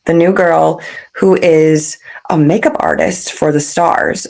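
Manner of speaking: ordinary talk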